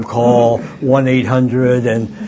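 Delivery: usual speaking voice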